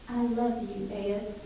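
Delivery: lightly imitated southern drawl, softly spoken; a soft hint of a Southern drawl; Arlene's southern drawl. Wife tells her devoted husband she loves him, soft light southern drawl. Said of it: "southern drawl".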